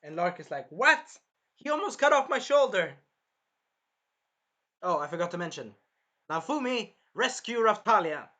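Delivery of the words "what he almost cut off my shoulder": high, loud outraged what; shocked protest. Acted. Lark protesting to Glass, loud and shocked. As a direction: yelp it out in disbelief, higher.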